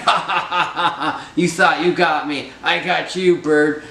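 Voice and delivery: high, loud laugh through the taunt; triumphant laughing. Acted. Winnie Bijou taunting the trapped bird, high loud laughing gloat. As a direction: cackle and crow over the trapped bird. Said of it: "Winnie Bijou is laughing".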